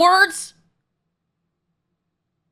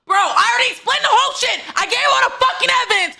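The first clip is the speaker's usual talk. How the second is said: frustrated rant; 7 semitones higher than usual. Real, not acted. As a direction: rant at the game, laughing through it. Ranting after losing.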